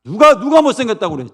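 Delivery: high, indignant outburst; acted motherly outrage; angry mother. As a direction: protest loudly on your child's behalf. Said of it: "이러면서 막 화를 내죠, 엄마가".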